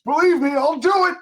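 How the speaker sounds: high, loud desperate threat; threatening shout. Acted. Cornered mutant insists he will hurt Robin, desperate shout.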